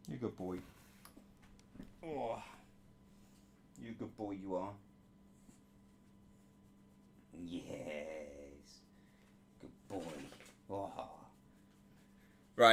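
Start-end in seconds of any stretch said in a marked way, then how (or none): 0.1-10.9 very soft, low praise; murmuring to the dog. Real, not acted. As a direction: hush it as he settles.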